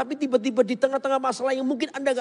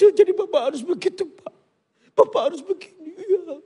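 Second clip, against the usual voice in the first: higher, louder, voice breaking into tears; tearful advice; 7 semitones higher, 5 dB louder than usual. Retold or imitated. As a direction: counsel while crying and half laughing. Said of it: "Jadi saya nangis". (menasihati sambil menangis)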